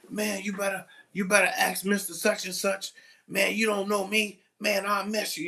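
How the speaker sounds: student threatening the teacher, high menacing voice. Retold or imitated. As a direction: imitate a kid making threats. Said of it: "he's like".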